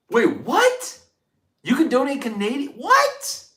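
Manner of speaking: high, rising squeals of disbelief; can't believe it, voice shooting up; shocked disbelief. Real, not acted. Shocked by a Canadian donation.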